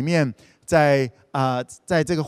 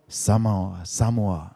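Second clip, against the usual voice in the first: lower, gentle calling of a name; 6 semitones lower than usual. Acted. God calls the sleeping boy Samuel, low and gentle.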